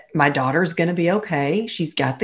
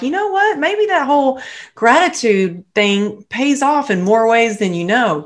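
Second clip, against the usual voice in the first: excited realization; 7 semitones higher than usual. Retold or imitated. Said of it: "And I'm like".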